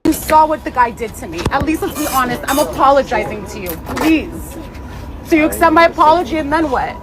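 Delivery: real distraught plea to the staff; desperate pleading. Real, not acted. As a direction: beg them to believe you, voice straining high. Begging employees at incident scene.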